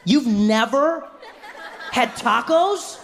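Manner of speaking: very slow, high-pitched incredulous question; mock disbelief. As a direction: stretch every word in exaggerated shock. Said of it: "they can't believe you haven't had".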